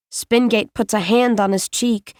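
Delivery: usual speaking voice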